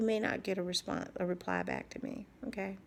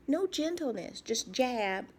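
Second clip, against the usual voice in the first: normal talking voice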